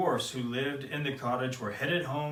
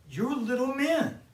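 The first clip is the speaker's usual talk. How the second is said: put-on much higher, bright girlish voice; delighted discovery; 12 semitones higher, 4 dB louder than usual. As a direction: marvel at the little men.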